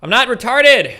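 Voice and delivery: high, loud protest; indignant shout. Real, not acted. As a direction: shout it back, offended. Denies insult loudly.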